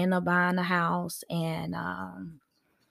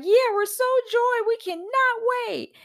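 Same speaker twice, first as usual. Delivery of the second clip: mock gushing, much higher and louder; gushing new parent; 14 semitones higher, 3 dB louder than usual. Mocking expecting parents asked if ready, hammed-up gushing. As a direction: ham up an overeager expecting parent, all squeals. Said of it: "Don't be like".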